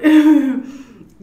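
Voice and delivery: loud high laugh. Real, not acted. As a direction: burst out laughing. Laugh after the quote.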